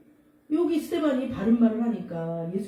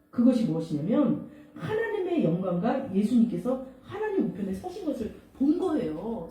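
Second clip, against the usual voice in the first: ordinary delivery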